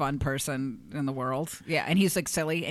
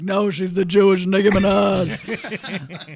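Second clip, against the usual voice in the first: imitating [name]; 3 semitones higher, 7 dB louder, much slower than usual. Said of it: "He's like".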